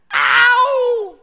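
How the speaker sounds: long high yelled ow, mimicking an echo; echoed pain yell. Canyon echoing the hurt kid, yelling. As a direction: howl it back at full volume. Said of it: "he hears from across the canyon".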